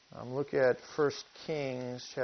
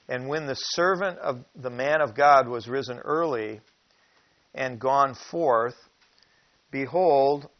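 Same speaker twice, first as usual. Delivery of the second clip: scripture reading; 6 dB louder than usual. Acted. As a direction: read it out like holy writ. Reads Kings verse aloud.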